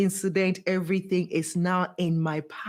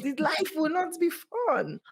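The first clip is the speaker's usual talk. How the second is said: ordinary talk, nothing marked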